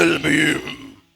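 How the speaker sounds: loud, low, slow divine call; God's booming voice. Acted. God calls out so strongly, in a loud low boom. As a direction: boom it out deep and drawn-out. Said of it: "Si Dios habla tan fuerte".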